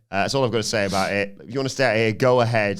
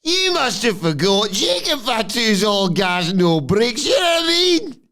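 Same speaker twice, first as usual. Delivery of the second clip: much higher, slow, loud catchphrase; high loud Fatu boast; 12 semitones higher, 5 dB louder, slower than usual. Retold or imitated. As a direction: deliver the catchphrase with swagger.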